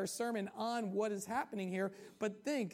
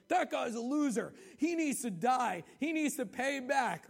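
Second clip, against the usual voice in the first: louder, higher furious outburst; enraged king; 4 semitones higher, 6 dB louder than usual. Retold or imitated. King David raging at the man in the story, loud and angry. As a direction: spit it out like a king in a rage. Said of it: "David's all in rage".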